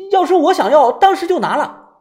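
high, loud, agitated retort; increasingly worked up. A narrator's reading. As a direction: get heated defending yourself. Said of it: "越说越激动".

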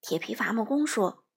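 usual speaking voice

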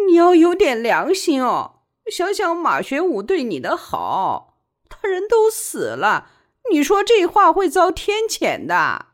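high, tearful scolding; weepy, wailing admonishment; crying reproach. A narrator's reading. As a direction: appeal to her conscience through tears. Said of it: "万小景还是哭， 说".

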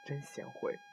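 hushed, understated half-smile; mildly wry, barely voiced; half-smiling. A narrator's reading. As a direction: say it softly with a faint teasing half-smile. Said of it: "似笑非笑地说".

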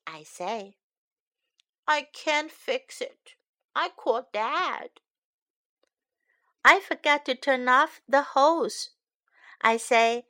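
put-on lively storybook read-aloud for kids; picture book read to young learners; reading to children. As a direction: read it brightly to little listeners.

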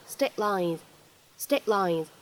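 "state lines" with the t held, not released; repeated "state lines" with unreleased t; incomplete plosion. Shown as a demonstration. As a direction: swallow the t of "state" before "lines". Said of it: "我们可以读成state lines".

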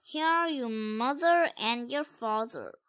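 ordinary delivery

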